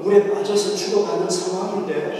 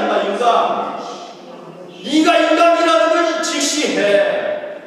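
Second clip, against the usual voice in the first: mocking scold; 7 semitones higher, 5 dB louder than usual. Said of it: "이렇게 하실 수 있잖아요".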